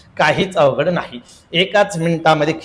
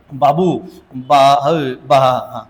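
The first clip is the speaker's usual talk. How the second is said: stammering Babu; 5 semitones lower, much slower than usual. Acted.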